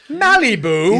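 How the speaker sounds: loud, high disbelief; incredulous. Acted. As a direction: say it like it's absurd. Incredulous repeat of place name.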